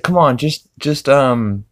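imitating Lois. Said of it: "Lois is like".

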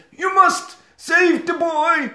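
very high, slow quavering plea; high, slow hammed-up dying voice; dying mystic. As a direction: gasp it out like a dying seer. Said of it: "Voodoo John says".

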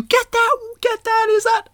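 very high shrill panicky granny; high alarmed old lady. Retold or imitated. Voicing alarmed old ladies.

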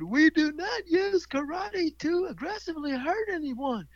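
high, slow class chant; mock children's sing-song answer; kids reciting. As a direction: recite it back in unison like schoolkids. Imitating kids chanting back to teacher.

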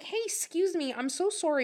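high, sweet, overly polite; bright apologetic service voice; customer service voice. Retold or imitated. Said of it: "I had my customer service voice".

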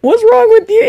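high, mocking repeat of his question; mimicking a careless question, high and mocking; mocking high whine. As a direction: parrot him in a squeaky voice. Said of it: "He said that to me".

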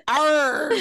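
comic pirate outburst of dismay; mock pirate cry. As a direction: groan "Arg!" like an exasperated pirate.

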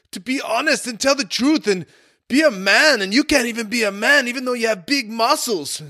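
high-pitched imitation of a German boss scolding in a German accent. German restaurant owner scolding a lying teenage busboy, mock German accent. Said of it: "laid into me in his German accent".

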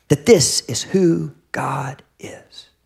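slow, hushed whisper; awed whisper. Real, not acted. Pastor to the congregation about God, whispered in awe. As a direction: breathe it out in awe.

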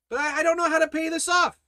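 high, fast, stammering worrier; mock fretting. Worried believer protesting about a debt, mock fretting. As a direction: rush out a hammed-up panicky excuse. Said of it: "you're worried and fretting and fearing".

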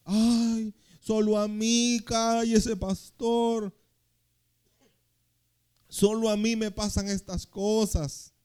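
mock the one playing the victim, drawn out; whiny self-pity. Preacher mocking a complaining church member, whiny. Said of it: "Deje de hacerse la víctima".

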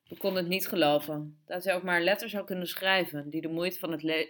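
ordinary delivery

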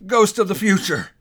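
high, loud fearful cry; exclaimed. A narrator's reading. Scrooge crying out to the silent phantom, loud and high. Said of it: "he exclaimed".

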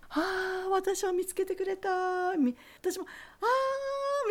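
high, gushing delight; breathless excited reunion cry; overjoyed. Retold or imitated. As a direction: squeal with happy relief at seeing her. Thrilled reunion with teacher.

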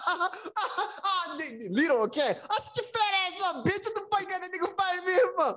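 high, heated mock arguing; mock argument. Lee and Tim trading insults, high heated mock argument. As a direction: talk trash, half laughing.

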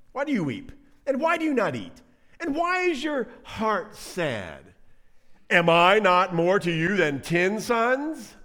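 high, drawn-out hammed-up questioning; mock exasperated husband. Elkanah questioning his sad wife, mock exasperation. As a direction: plead like a clueless husband, overplayed.

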